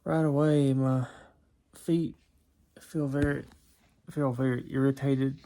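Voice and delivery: sleepy, groggy voice; drowsy just-woken mumble; just woke up. Real, not acted. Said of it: "I'm waking up from my nap".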